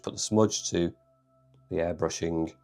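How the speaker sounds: normal talking voice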